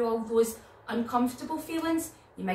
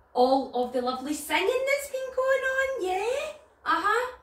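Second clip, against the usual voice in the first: excited high chatter; 11 semitones higher, 4 dB louder than usual. Acted. Chatting with duck puppet.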